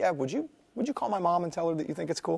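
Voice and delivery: needy plea. Retold or imitated. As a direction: ask like a kid wanting praise. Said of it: "And I'm like".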